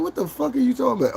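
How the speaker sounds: high baffled question; high-pitched disbelief; confused reaction. Retold or imitated. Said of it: "I was like".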